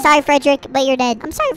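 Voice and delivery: ordinary delivery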